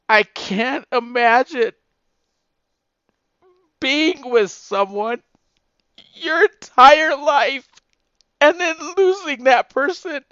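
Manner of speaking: real tears, voice breaking high and loud, slow; fighting back crying as it rises; choked up. Real, not acted. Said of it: "I'm sorry I got emotional".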